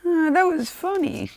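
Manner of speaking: slow, loud, very high voice ending in giggles; drawn-out falsetto delight tipping into laughter; maniacal amusement. Acted. Gloating over paralyzed victims, high giggly delight. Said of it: "he starts laughing maniacally".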